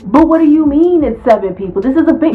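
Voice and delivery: high, loud mock protest; indignant customer. Customer outraged at being told seven people, high and loud. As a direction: squeal it out like an outraged diner.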